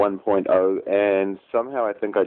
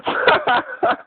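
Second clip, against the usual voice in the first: burst of high laughter. Real, not acted. Laughing at own joke.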